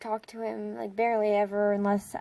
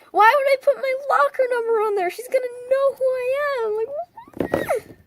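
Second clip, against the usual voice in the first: much higher, louder, near-crying self-scolding; mock panicked wail; 7 dB louder than usual. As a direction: whine at yourself like it's a disaster. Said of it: "being the idiot that I am".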